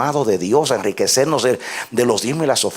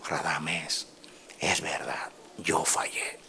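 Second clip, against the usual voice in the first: whispered, much softer admission; hushed confession; 7 semitones lower, 14 dB quieter, much slower than usual. Retold or imitated. Humbled pastor confessing to Radamés, whispered. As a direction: breathe the words like a broken man.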